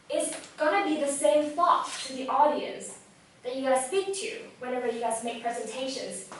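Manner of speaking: ordinary talk, nothing marked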